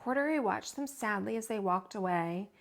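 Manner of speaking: usual speaking voice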